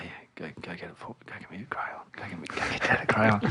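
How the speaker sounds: whispered, half asleep in bed; drowsy dad whispering to his daughter; sleepy whisper. Retold or imitated. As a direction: whisper it so you barely wake.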